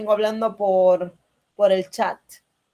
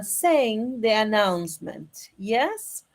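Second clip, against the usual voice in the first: normal talking voice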